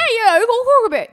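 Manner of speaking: put-on high, shouted English accent; loud, hammed-up English yell; mock English accent. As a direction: holler it in an over-the-top English accent. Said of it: "make up with the English accent".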